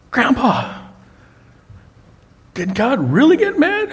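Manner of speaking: put-on high child voice, asking wide-eyed; imitating a five-year-old girl asking grandpa; little granddaughter. Retelling, grandpa plays his granddaughter asking in a high voice.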